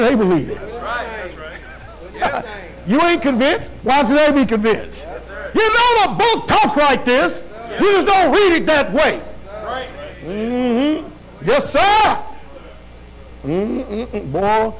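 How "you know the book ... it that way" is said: higher, louder pulpit voice; fiery sermon emphasis; preaching. Acted. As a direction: press the point home. Preacher rising.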